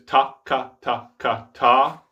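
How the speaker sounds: separate, even "ta, ka" alternation; match a firm K to the T in "ta, ka"; steady alternating "ta, ka", ending on "ta"; standard double tongue. Shown as a demonstration. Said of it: "That is the standard double tongue".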